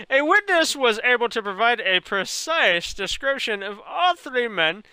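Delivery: careful corrected reading of "precise description" in a put-on Indian accent. Streamer rereads the line correctly in a mock Indian accent. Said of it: "talk this long with an Indian accent".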